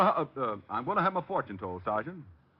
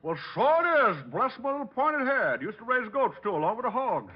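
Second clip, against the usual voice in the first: hillbilly put-on; 10 semitones higher than usual. Detective in disguise calling the psychic, country accent. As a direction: play the hog farmer yokel.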